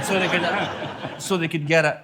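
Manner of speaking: ordinary talk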